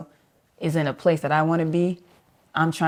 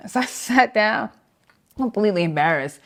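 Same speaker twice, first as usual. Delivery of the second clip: ordinary talk, nothing marked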